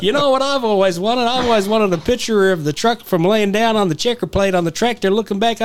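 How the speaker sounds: high-pitched mock Canadian trucker; Canadian truck driver. Canadian trucker asking for a shot from the checker plate, imitated eagerly. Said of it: "with a Canadian truck driver".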